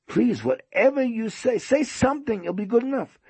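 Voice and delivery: very high, insistent plea; pleading host. Retold or imitated. Host begs silent guest for any teaching, pleading. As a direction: beg in a high, urgent voice.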